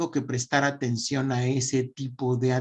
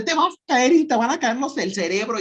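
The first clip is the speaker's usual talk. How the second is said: a much higher, louder panicky scolding mother; scolding mom; 9 semitones higher, 4 dB louder than usual. Retold or imitated. Mother scaring her child: frantic scolding yell. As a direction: shriek the warning like a frantic mom. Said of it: "las historias de las mamás de".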